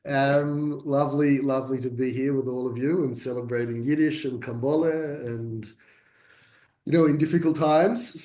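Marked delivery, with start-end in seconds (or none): none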